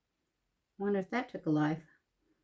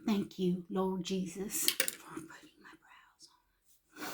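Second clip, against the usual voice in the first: slow, breathy prayer whisper; 10 dB quieter than usual. Real, not acted. Thanking God for her eyebrows, slow whisper. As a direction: whisper it slowly like a grateful prayer.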